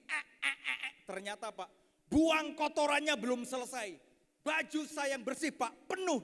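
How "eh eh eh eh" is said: short, repeated straining grunts; imitated straining baby. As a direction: mimic a baby pushing out poop. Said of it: "wajahnya itu begini". (menirukan bayi mengejan)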